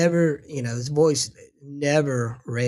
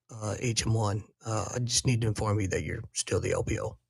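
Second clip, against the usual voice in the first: chastened surgeon, quiet and lower; sheepish quiet; 6 semitones lower, 9 dB quieter than usual. Retold or imitated. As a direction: lower and softer, a meek retold surgeon.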